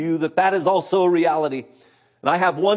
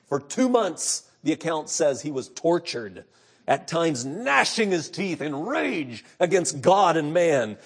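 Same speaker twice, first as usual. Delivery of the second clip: normal talking voice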